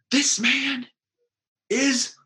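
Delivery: high and slow gushing praise; excited man. Retold or imitated. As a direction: stretch out amazing with delight. Man retold praising the narrator.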